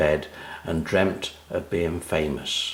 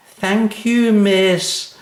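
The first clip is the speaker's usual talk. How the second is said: put-on high, prim girlish thanks; a prim schoolgirl voice; very polite; 13 semitones higher, 7 dB louder, much slower than usual. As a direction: thank the teacher sweetly and properly. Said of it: "very politely".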